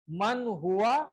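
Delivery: من ہوا drawn out very slowly, each sound clear; slow, careful teacher model of من ہوا; slow model question. Shown as a demonstration. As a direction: pronounce من ہوا slowly for learners to copy. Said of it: "اگر میں آپ سے پوچھوں".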